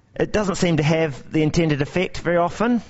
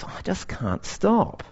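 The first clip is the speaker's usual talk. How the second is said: slow, soft, pleading confession; helpless admission; 5 semitones higher, 4 dB quieter, slower than usual. Acted. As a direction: admit it quietly and plaintively. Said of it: "my response is".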